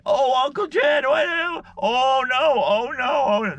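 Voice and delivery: hammed-up high frantic nephew; imitating panicked nephew. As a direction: wail like a scared kid watching. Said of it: "he was going".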